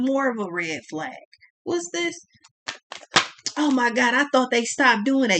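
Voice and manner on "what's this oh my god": ordinary talk, nothing marked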